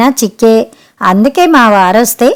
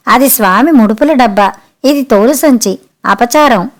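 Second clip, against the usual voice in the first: ordinary delivery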